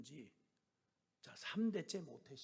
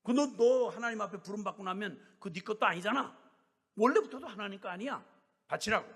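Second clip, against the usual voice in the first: louder, higher, demanding; scolding; 6 semitones higher, 10 dB louder than usual. Retold or imitated. Elder browbeating the sister to give it, loud. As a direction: hammer it home, offer it up. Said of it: "막 닦아 세운 거예요".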